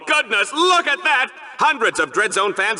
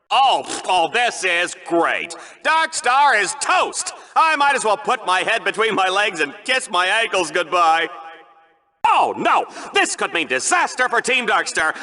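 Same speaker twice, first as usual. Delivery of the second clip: normal talking voice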